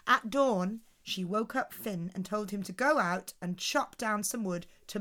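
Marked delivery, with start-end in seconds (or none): none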